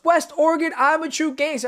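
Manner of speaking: hammed-up gangster claim; imitating Earl. Host imitating the guest's co-host bragging, mock tough. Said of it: "He goes".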